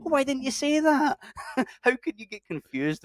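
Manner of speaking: high-pitched retold frustrated complaint; playfully exasperated, voice shooting high; mock exasperation. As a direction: whine it in hammed-up disbelief. Retold annoyance at bad directions.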